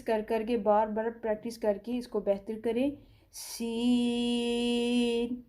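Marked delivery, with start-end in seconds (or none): 3.3-5.4 سین with the vowel stretched long; lengthened letter. Shown as a demonstration. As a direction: draw out سین for two and a half counts. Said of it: "جو لفظ شین".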